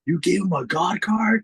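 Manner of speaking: slow, high, whining disbelief; whiny jealous kid. Retold or imitated. Cinco jealous of another kid's God card, high and whiny.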